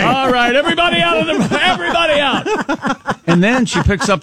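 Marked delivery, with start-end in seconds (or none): 0.0-1.6 commanding shout. Pretend. Mock raid order continues. 1.6-3.3 high, slow order through laughter; laughing command. Pretend. Mock raid order, laughing.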